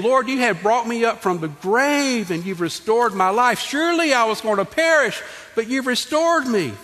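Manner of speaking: preacher's voice lifting to a peak; fervent preaching. Acted. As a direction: cry it out to the congregation. Preaching David's words, climbing.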